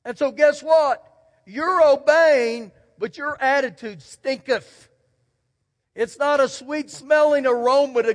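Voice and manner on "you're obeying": drawn out. Real, not acted. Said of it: "slow stressed point".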